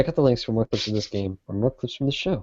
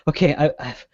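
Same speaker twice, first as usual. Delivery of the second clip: ordinary talk, nothing marked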